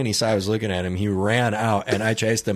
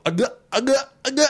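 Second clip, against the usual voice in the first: high, strained "agua, agua" while pouring; gulping thirsty man; 12 semitones higher, faster than usual. Retold or imitated. Customer dumping water on his head, high strained chant. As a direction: gasp it out mid-drench.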